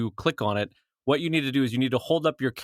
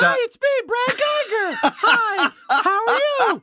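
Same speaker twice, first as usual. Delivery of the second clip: silly greeting voice. Retold or imitated. Cohost trying out the goofy thank-you for backers, very high and laughing. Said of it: "Brad mimicking the silly greeting".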